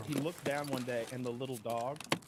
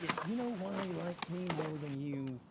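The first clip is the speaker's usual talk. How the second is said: lightly put-on character voice, raised in pitch; mock confession; 5 semitones higher than usual. Man telling the owner about his bacon, high mock confession.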